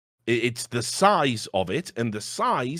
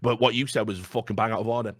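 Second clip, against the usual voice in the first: ordinary talk, nothing marked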